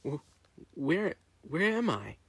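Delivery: slow, high, groggy confusion; just coming to, scared; dazed and frightened. Acted. Waking from sedation, dazed.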